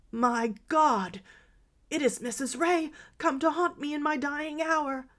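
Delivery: terrified, high and loud; high panicked cry of terror; cry of deadly fear. A narrator's reading. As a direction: shriek it as if seeing a ghost. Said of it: "uttered a cry of deadly fear".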